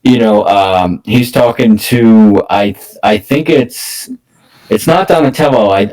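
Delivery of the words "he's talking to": usual speaking voice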